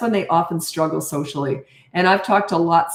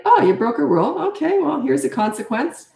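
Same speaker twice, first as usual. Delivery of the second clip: higher, light, businesslike; matter-of-fact, no anger; 7 semitones higher than usual. Shown as a demonstration. Coach demos his tone to a rule-breaking kid, calm and breezy. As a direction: hand out the consequence like a casual transaction. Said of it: "That's how I talk. That's the tone of voice".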